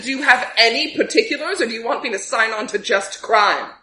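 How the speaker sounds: ordinary talk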